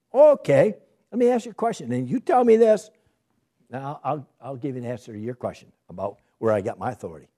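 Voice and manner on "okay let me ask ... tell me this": a much higher, animated Jesus challenging them; higher, lively challenge; voicing Jesus. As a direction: put the question to them like a teacher testing. Voices Jesus posing a question.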